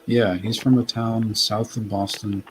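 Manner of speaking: ordinary talk, nothing marked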